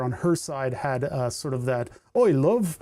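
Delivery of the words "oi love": brief mock Northern English call; put-on Northern English greeting; Northern English accent. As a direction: imitate a grandfather's Northern England accent. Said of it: "kind of accent".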